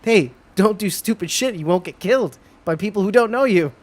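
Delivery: acted nagging reminder; exasperated warning. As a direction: warn her like a fed-up minder. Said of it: "this lady has to keep reminding her".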